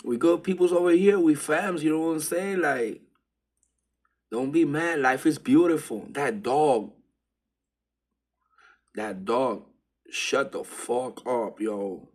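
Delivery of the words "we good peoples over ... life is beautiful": higher and louder, eager; friendly pleading. Retold or imitated. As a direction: talk the dog down, buddy-style. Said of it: "And I'm like".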